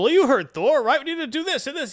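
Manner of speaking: high, loud call to the assembled hall; rallying announcement. Retold or imitated. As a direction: imitate Volstagg rousing a crowd. Volstagg addresses everyone assembled.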